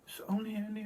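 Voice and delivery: high-pitched quote of the woman; imitated woman's reaction. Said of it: "And she's like".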